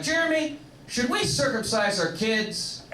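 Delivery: mock asking friend. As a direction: ask it like a clueless friend in a high voice. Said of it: "So they ask me questions like".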